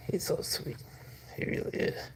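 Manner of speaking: quiet unvoiced praise; soft whisper. Real, not acted. Owner whispers about the sweet cat. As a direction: breathe it out softly.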